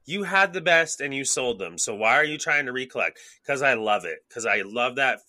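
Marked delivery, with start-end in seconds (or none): 0.0-3.2 high, loud mock scolding of himself; scolding inner voice. Scolding himself for buying back old tapes, mock-scolding and high. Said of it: "But I'm like".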